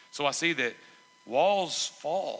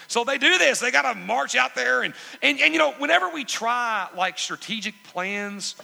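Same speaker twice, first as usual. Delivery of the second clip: loud, high, driving sermon delivery; impassioned preaching; 9 semitones higher, 7 dB louder than usual. Real, not acted. Preacher urging the congregation to put the Lord first, impassioned preaching. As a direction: preach it out at full force.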